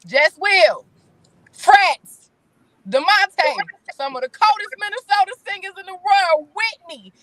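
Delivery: high, loud roll call of names; proud shout-out. Real, not acted. Guest hyping local singer friends, loud proud shout-out. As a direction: call out each name loud and high, with pauses.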